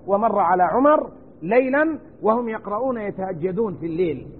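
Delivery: normal talking voice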